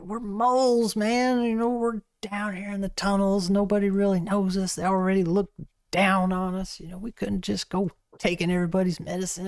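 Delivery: lightly voiced older mole near tears; a hint of holding back tears; mild tearful mole. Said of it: "holding back tears".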